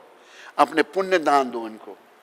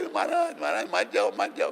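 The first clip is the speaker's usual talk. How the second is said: acted plaintive crying out; imitated pleading cries; 6 semitones higher, 4 dB quieter than usual. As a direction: beg him to stay in a high voice. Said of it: "सब चिल्लाए कि".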